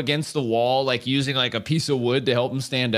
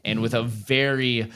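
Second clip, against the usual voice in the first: closing narration; 4 semitones lower than usual. Acted. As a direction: bring the episode to a solemn close. Season finale closing narration.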